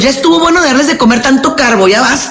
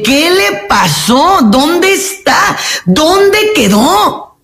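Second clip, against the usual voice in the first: very slow, climbing higher and higher; rising lament; 7 semitones higher, much slower than usual. Acted. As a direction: stretch each question upward in disbelief. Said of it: "rhetorical lament building".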